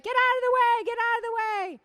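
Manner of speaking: high, mock yell of a tailgater; impatient driver yelling. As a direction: shout them out of the lane, mockingly. Wife imitates him shouting at drivers.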